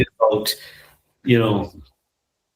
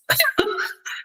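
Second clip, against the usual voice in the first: a light giggle up high; airy amused giggle; breathy high laugh; 4 dB quieter than usual. Real, not acted. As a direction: laugh it off softly. Laughing at joining for tea.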